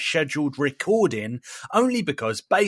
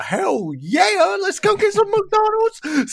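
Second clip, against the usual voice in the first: high, loud mock squeal, stretched out; hammed-up high-pitched cheer; excited whoop; 5 dB louder, slower than usual. Couple deciding on a McDonald's run, hammed-up excited whoop. Retold eager yes to McDonald's.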